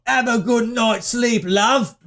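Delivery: hammed-up British send-off; mock British accent. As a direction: belt the quip in broad British. Repeats the quip in British accent.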